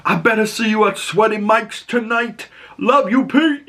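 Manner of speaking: high voice, hamming up the teammate; high mock jock voice. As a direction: pitch it up like an excited jock teasing. Re-enacting Lonnie's line.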